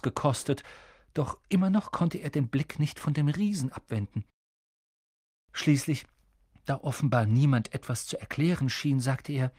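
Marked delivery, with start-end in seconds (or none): none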